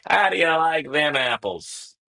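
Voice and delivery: show-off taunt at the opponent; cocky taunt. Pretend. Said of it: "And we say".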